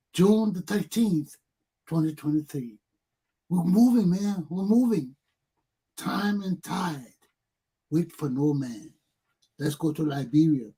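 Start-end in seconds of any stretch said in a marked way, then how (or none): none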